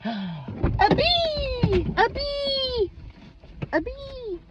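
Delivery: gasp, then a very high, squeaky, frantic plea; mock bee panic. Acted scene shooing away Mister Bee, very high and frantic. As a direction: shriek at the bee like a terrified child.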